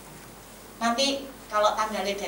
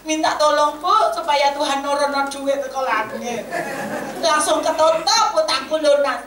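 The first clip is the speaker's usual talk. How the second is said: loud, raised mock pleading; mocking wishful debtor; 5 semitones higher, 9 dB louder than usual. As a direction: act out a debtor's hammed-up wish. Mimics debtor wishing for heavenly money.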